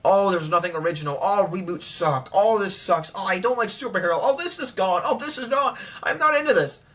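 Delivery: whiny, rising mock complainer voice; sneering impression of grumbling fans; mocking haters. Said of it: "I hear people saying a lot".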